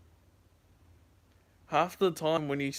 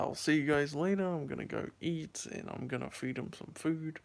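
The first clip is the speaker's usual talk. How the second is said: ordinary delivery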